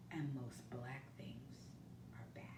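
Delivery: storyteller's ominous whisper; hushed whisper. A narrator's reading. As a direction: drop to a whisper on the dark words. Dramatic line in picture book.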